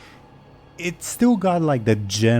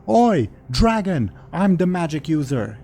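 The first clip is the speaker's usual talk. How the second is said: calling out dragon; 6 semitones higher, 4 dB louder than usual. Acted. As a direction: bellow to draw the dragon. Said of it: "he says to the dragon".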